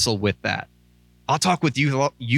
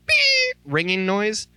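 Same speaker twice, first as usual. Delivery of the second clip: mimicking a beep; 11 semitones higher, slower than usual. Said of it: "this really high pitched".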